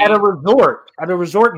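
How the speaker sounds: loud, high incredulous repeat; scandalised disbelief. Real, not acted. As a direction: harp on it in outrage. Scandalised reaction to story.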